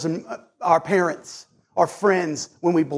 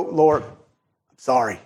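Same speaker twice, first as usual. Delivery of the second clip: low, slow, simple sorry; slow sincere apology; 6 semitones lower, slower than usual. Retold or imitated. Believer confessing to the Lord, slow and sincere. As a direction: say it once, plainly and humbly.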